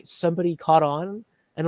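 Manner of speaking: ordinary delivery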